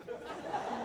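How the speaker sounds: normal talking voice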